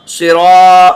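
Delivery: ordinary talk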